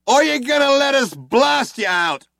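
loud, high demand; cop shouting. A narrator's reading. Cop shouting at the fugitives, loud and high. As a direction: shout it across the room. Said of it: "shouted one of the cops again".

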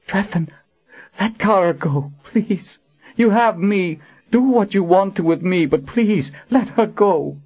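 high-pitched, imploring voice; desperate pleading. Acted. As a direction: beg the captor for a friend's life. Begs captor to spare her friend.

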